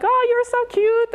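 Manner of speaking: high, loud mock squeal of schoolkids; squealing kids. Schoolkids gushing over her daughter, high squeal. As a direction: imitate kids gushing over a cute girl. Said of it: "They were screaming at her like".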